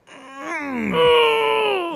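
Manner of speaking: high hummed buzzing noise; mock buzz. Imitating a phone vibrating in a prisoner's hiding spot, high hum. Phone ringing sound from tube.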